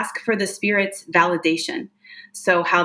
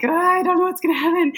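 high, fast nervous sitter; nervous client; 11 semitones higher, much faster than usual. Retold or imitated. Imitating a nervous client arriving at a reading, high and fast. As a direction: mimic a jittery client, pitched up and quick. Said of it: "they're usually very nervous and like".

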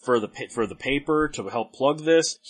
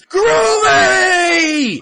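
much higher, louder drawn-out cheer; audience scream; 10 dB louder than usual. Retold or imitated. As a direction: scream it like a whole crowd of fans. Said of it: "the whole audience screams".

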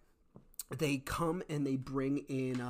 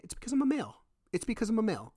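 much higher-pitched insistence; real, high-pitched exasperated protest; emphatic protest; 8 semitones higher, 4 dB louder, faster than usual. Real, not acted. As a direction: push it up high, insisting on the point. Protesting why he was treated differently.

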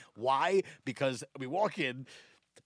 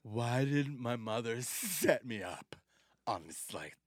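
low, slow, dragging complaint; mock disdain, trailing low and soft; unimpressed blind date; 6 semitones lower, 5 dB quieter, slower than usual. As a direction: play a bored, reluctant date. Actress resenting being set up.

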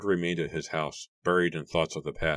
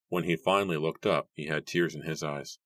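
plain reading voice

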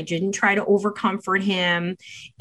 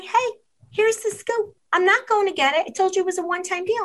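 firm refusal; 11 semitones higher, faster than usual. Retold or imitated. Mom refusing son in story.

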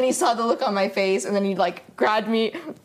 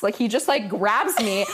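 ordinary delivery

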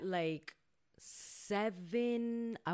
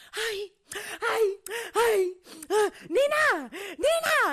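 high, loud frantic calling of Nina; mock panicked mother calling; frantic mom calling; 11 semitones higher, 8 dB louder, slower than usual. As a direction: do mom shouting up the stairs. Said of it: "I'm laying in bed like".

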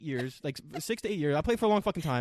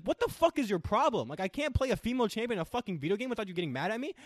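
higher-pitched exasperated protest; retold angry complaint; 6 semitones higher than usual. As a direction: act out being fed up with an accuser. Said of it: "I would get mad at her".

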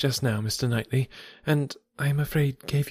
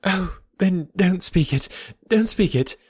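eager cry; 8 semitones higher than usual. Acted. Emma begging Knightley not to speak, eager high cry. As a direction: stop him before he says it. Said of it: "she eagerly cried".

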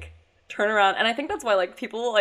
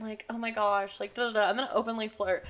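normal talking voice

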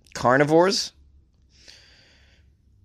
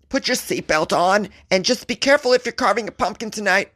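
sing-song mock scolding, much higher; mock nanny voice; 8 semitones higher than usual. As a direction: parody a fussy safety official. Mocking overprotective government.

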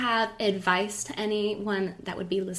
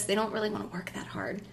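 whispered aside; 7 dB quieter than usual. Retold or imitated. Reflective quote trailing into whisper.

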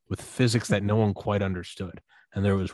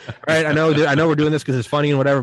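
louder, rushed nervous plea; nervous coaster rider; 5 semitones higher, 8 dB louder, faster than usual. Retold or imitated. Friend on the roller coaster climb pleading to the guys, nervous and loud. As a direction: blurt it out fast, half laughing, half scared.

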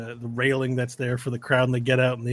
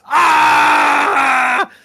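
loud, high yelp of pain; pained yell; 12 semitones higher, 12 dB louder than usual. Acted. GM voicing the hurt fighter, a loud high yelp of pain. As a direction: yell out as the stall lands on his toe. NPC cries out, stall on toe.

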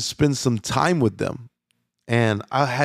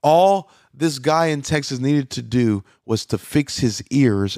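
ordinary talk

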